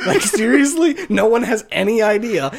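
high, loud laugh through disbelief; real laugh at how silly it is. Real, not acted. Host laughing at the game's story in disbelief.

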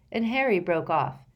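ordinary delivery, nothing marked

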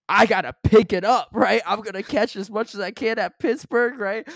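loud, pumped-up self-talk; psyching himself up loudly; fired-up. Retold or imitated. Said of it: "No, I was like".

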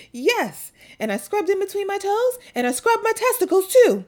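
put-on high, loud proud little boy. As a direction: chirp it like a proud kid. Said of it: "he goes".

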